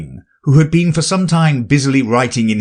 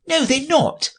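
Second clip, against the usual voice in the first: high respectful rabbit voice. Acted. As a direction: politely correct the king, high.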